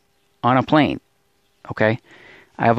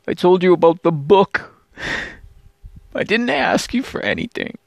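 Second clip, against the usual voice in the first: tearful pleading; 5 semitones higher than usual. Real, not acted. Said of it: "I'm crying".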